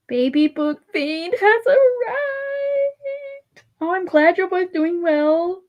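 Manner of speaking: high, drawn-out squeal of joy; thrilled coo, stretched out long and high; emotional delight. Real, not acted. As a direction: gush slowly in a high, tearful voice. Moved by friend's baby news.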